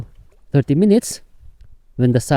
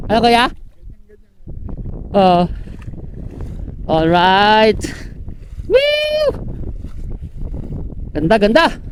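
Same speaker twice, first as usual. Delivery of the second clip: excited cheering; 8 semitones higher than usual. Real, not acted. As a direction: gush over the fish. Admiring the catch.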